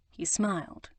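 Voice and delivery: ordinary delivery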